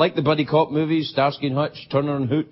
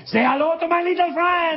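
Al Pacino Scarface impression; 12 semitones higher than usual. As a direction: bark it like a coked-up Tony Montana. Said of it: "Al Pacino played the Cuban cocaine dealer".

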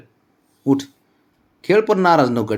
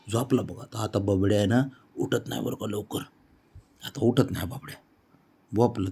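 much softer, hushed so he stays asleep; 9 dB quieter than usual. Real, not acted. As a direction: drop the voice to a hush. Baby falling asleep, hushed.

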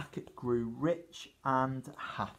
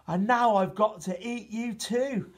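whiny giant; 6 semitones higher, 4 dB louder than usual. Acted. Giant whines before eating Jack.

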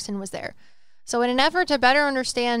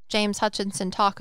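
ordinary talk, nothing marked

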